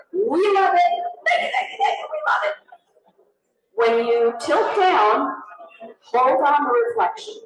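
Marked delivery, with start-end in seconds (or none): 0.1-2.6 high, rapid gushing thanks, laughing at the end; overjoyed client, bubbling over. Retold or imitated. NPR client raving about the shot, high delighted gush. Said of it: "they said".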